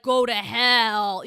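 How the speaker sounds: loud, slow, drawn-out command; mock heroic damnation. Podcaster imagining Jesus condemning demons, loud and slow. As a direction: boom it out like a hammed-up movie hero. Voicing Jesus as movie hero.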